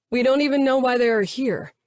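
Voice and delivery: lightly voiced snide man, mocking. A narrator's reading. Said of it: "Mateo said snidely".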